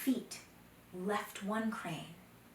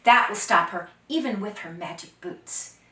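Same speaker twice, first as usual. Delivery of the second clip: confident boast; 2 semitones higher, 13 dB louder than usual. Acted. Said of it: "Oh, Dakota laughed and he said".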